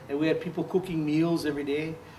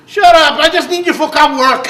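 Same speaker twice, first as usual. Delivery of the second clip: much higher, much louder mock shout, laughing; George's yell; 11 semitones higher, 16 dB louder than usual. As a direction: bark at him to stop apologizing. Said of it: "he told me".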